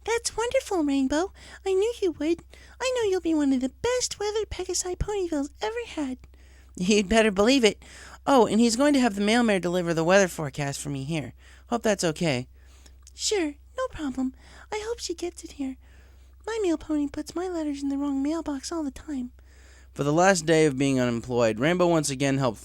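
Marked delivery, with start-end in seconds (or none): 0.0-6.2 gently proud of her friend; gentle Fluttershy. Acted. Narrator voices Fluttershy. 6.8-12.5 put-on higher, eager young pony voice; nervous, keen to impress the boss; young tomboy pony. Job applicant to the director, eager young voice. Rainbow to Fluttershy. 13.1-19.3 very high, soft shy pony voice; gently proud of her friend; gentle Fluttershy. Acted. Fluttershy replies.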